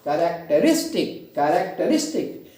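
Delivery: Characteristic with RIS punched; characteristic said ka-rak-te-RIS-tik; stress on RIS. Shown as a demonstration. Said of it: "stress करेंगे".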